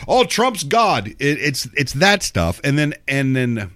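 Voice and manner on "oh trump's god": talk radio caller. Retold or imitated. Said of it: "you know".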